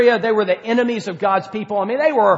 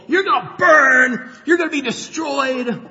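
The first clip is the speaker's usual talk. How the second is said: gloating prophet's mock threat; 6 semitones higher than usual. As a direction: gloat over them like a self-righteous prophet. Jonah gloating at Nineveh's people.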